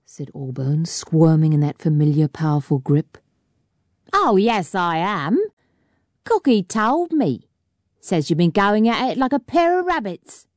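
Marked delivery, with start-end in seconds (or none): 4.1-10.5 mocking miner. A narrator's reading. Welshman teasing.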